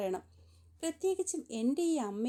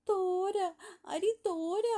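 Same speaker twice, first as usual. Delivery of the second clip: put-on high-pitched baby-talk cry of alarm; acted little child's wail, pitched up; wailing toddler; 7 semitones higher, much slower than usual. A narrator's reading. Little girl sees blood on her hand, high acted wail. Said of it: "പിന്നെയും വലിയ വായിലെ നിലവിളിക്കാൻ".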